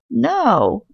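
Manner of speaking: a high, quick cry of refusal; cries out. A narrator's reading. Old lady crying out to stop the policeman, high cry. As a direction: stop him with a sudden cry. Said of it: "cried the old lady".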